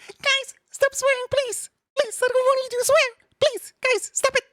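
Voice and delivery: very high mock-pleading voice; squeaky pleading. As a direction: beg the viewers in a tiny high squeak.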